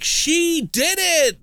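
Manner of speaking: big, high, slow triumphant cry; excited announcer shout. Retold or imitated. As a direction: announce the win like a show host. Game show reveal shout.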